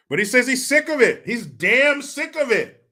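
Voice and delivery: high, mock-exasperated voice, slowing down; mock Newsom. Host mocking the governor's outburst, high exaggerated voice. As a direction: lean on the complaint in a high put-on voice. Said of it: "They sound similar because Gavin Newsom's vocal cords".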